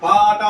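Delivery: loud, stretched aa in పాట; drawn-out aa in పాట. Shown as a demonstration. Teacher giving a word to spell, long loud aa in పాట. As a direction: hold the first vowel of పాట loudly.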